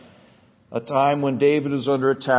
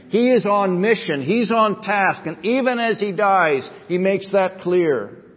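higher, emphatic pulpit delivery; fervent preaching; 5 semitones higher, 3 dB louder than usual. Real, not acted. Preacher shows congregation Jesus is on mission, fervent preaching. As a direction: build with conviction, stretching key phrases.

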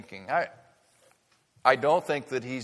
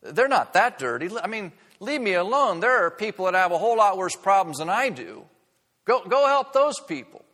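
high, loud, indignant protest; indignant refuser; 6 semitones higher, 5 dB louder than usual. Acted. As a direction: push the offer away, offended. Voicing a person refusing cleansing.

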